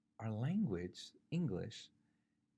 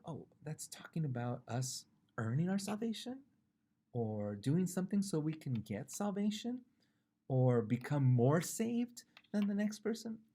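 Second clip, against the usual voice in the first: higher, rising mock questions; questioning objector; 6 semitones higher, 5 dB louder than usual. Preacher voices what some might wrongly think, questioning. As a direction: voice a doubter's guesses, each lifting at the end.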